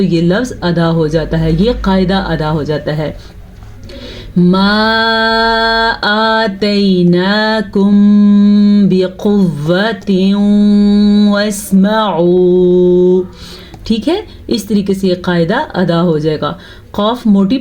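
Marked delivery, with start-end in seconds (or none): 4.4-13.2 slow melodic tajweed recitation; Quran recitation. Shown as a demonstration. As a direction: draw the vowels out like a Quran reciter. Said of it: "teacher recites the verse to teach".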